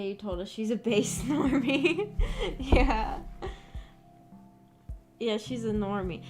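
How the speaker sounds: ordinary talk, nothing marked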